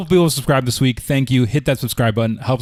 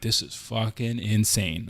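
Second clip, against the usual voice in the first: inner thought; 8 dB quieter, slower than usual. Retold or imitated.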